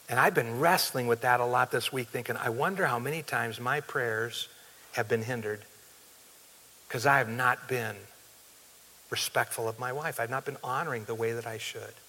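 soft, low, reflective admission; subdued confession. Real, not acted. Preacher admitting failings as a husband, hushed and humbled. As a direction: drop low and quiet, pausing as you confess.

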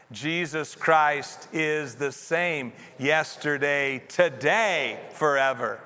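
measured group reading, "today" lifted and drawn out; stress on TODAY. Shown as a demonstration. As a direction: lean hard on the word "today". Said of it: "put the emphasis on a different word".

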